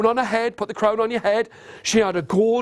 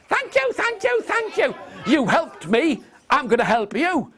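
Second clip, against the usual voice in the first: tiny fairy voice; 7 semitones higher than usual. Acted. The fairy queen thanks Stan, very high and small. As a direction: sound tiny and grateful. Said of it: "She said".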